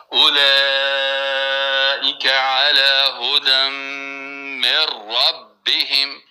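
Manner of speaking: very slow, elongated recitation; slow tartil. Shown as a demonstration. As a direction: stretch the madd fully, word by word. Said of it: "پنج انگشت باید چی شوه؟". (تلاوت کشیده و آهسته)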